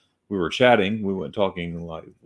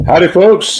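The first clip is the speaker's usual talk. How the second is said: loud howdy; 9 semitones higher, 14 dB louder than usual. Real, not acted. Loud greeting opening the livestream.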